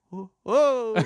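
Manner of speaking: loud, high whoas; dodging cries. Shown as a demonstration. Voice actor trying out noises for dodging people, high startled cries. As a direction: yelp as if ducking a blow.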